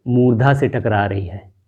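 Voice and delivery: ordinary talk, nothing marked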